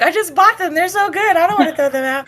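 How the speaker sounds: high-pitched, exaggerated lament; mock sad. Recruit sad about throwing out cough drops, mock sad. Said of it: "I was so sad".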